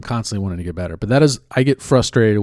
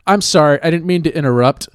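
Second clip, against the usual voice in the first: higher, louder put-on sorry; mock apology; 6 semitones higher, 8 dB louder than usual. Mimicking guys who say they didn't mean to interrupt, mock apologetic. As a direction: imitate an insincere apology for interrupting.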